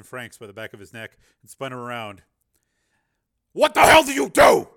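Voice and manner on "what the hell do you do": high, furious roar; angry full-force shout. Acted. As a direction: bellow it as an accusation. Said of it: "he shouted".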